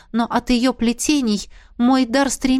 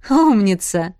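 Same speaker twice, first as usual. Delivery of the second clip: laughing praise; 3 dB louder, slower than usual. A narrator's reading. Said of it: "рассмеялась драконица".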